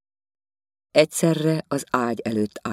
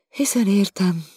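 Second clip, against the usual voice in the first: higher, complaining lady's voice; indignant lady; 10 semitones higher, 3 dB louder than usual. Acted. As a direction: voice her as a prim, offended lady. Viziné again. (felháborodott úriasszony magasabb hangon)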